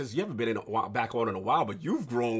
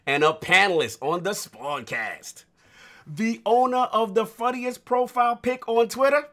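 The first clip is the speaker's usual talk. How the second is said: building, louder announcer call, slowing; announcer hype intro; 4 semitones higher, 8 dB louder than usual. Acted. Host hypes guest introduction.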